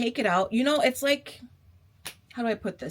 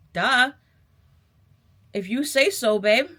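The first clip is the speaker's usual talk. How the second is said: sassy retort; 3 semitones higher, 7 dB louder than usual. Retold or imitated.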